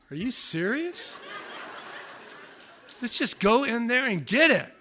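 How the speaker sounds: incredulous. Retold or imitated. Husband scoffing at praying wife.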